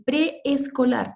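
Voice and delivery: "preescolar" slowed, with pre and es apart; two separate e beats in "preescolar"; split into syllables. Shown as a demonstration. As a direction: say "preescolar" slowly, breaking it after pre. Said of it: "Tenemos la separación ahí".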